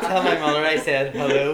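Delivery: mock dying words through a laugh.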